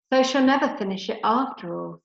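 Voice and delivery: ordinary talk, nothing marked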